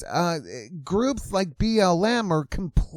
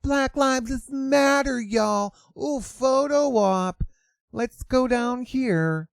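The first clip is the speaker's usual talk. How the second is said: higher, slow and airy mimicry; mock influencer voice; 9 semitones higher, slower than usual. Vlogger parodying the influencer again, higher and slower. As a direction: drag it out like a vain poser.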